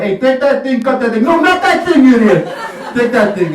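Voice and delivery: loud, high bossy New York Italian surgeon; hammed-up New York Italian surgeon yelling. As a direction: yell orders across the operating table. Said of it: "he's gonna be like".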